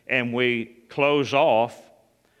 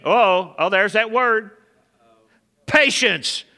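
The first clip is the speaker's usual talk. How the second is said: excited; 8 semitones higher, 7 dB louder, slower than usual. Real, not acted. Exclaims over the word patience.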